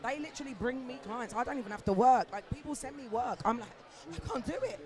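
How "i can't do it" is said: mock overwhelmed. Retelling herself turning work away.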